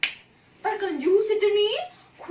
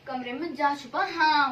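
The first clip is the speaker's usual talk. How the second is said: usual speaking voice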